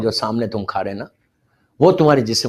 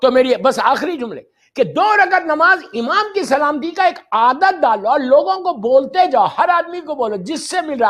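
impassioned preaching; 10 semitones higher than usual. Acted. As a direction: plead with the crowd at full force. Preacher exhorts congregation.

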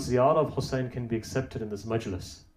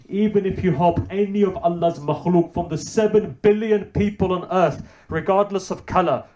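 raised, louder sermon delivery; impassioned preaching; 6 semitones higher, 7 dB louder than usual. Real, not acted. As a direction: build to a forceful, urgent exhortation.

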